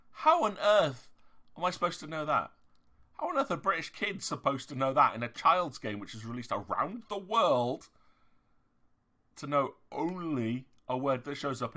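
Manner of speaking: loud, high incredulous complaint; how on earth, properly fed up; exasperated rant. Real, not acted. Venting to viewers about the slang, loud exasperation.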